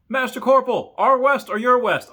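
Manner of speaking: high, loud earnest question; confused troop. Retold or imitated. Confused troop asks master corporal, high loud question. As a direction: ask it sincerely and loudly.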